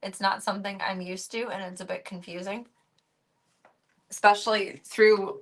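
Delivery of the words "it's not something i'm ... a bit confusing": ordinary delivery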